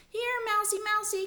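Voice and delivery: high sing-song mouse call; very high-pitched calling. A narrator's reading. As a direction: coax a hiding mouse in a high voice. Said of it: "called Lana".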